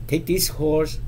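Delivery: ordinary delivery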